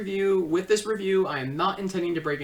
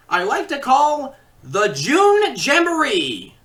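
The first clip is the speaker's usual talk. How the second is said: slow, loud, high title call; showman announcer; 5 semitones higher, 8 dB louder, slower than usual. Acted. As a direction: stretch out the show's name grandly. Names the show title.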